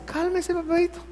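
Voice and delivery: lightly mock a mom calming her boy; mother calming her son as she spanks him, high coaxing voice; mother soothing. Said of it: "me dice".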